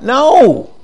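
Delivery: sudden rejecting "no!"; emphatic no. Acted. Breaks off griping with rebuke.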